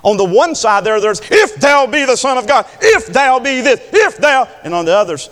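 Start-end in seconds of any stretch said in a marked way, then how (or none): 1.3-4.5 higher, louder challenge from the crowd; mockers' taunt. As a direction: throw it out as a repeated jeer. Acts mockers at the cross.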